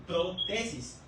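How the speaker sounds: "protesis" split as pro–TESIS; pro-TESIS pronunciation. Shown as a demonstration. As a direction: say "protesis" in two parts, weight on TE. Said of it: "Por eso se pronuncia". ("protesis" separado: pro… TESIS)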